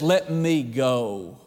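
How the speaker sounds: low, slow "Let me go", each word drawn out; the Lord pulling away, said low and slow; the Lord's words, slowed. Retold or imitated. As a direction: hold back and let it fall slowly. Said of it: "and the Lord says".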